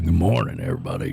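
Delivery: low, booming radio announcer greeting; deep radio voice. Retold or imitated. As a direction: drop into a deep DJ voice. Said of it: "you got that deep voice".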